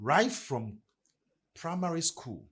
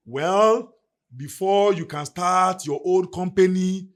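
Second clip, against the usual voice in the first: mock announcement; 6 semitones higher, 10 dB louder, slower than usual. Mocking the old programming.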